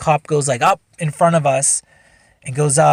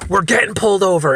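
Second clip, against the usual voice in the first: higher-pitched mock alarm; panicky; 6 semitones higher, 4 dB louder than usual. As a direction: imitate a buddy starting to panic. Buddy panics at spotting police.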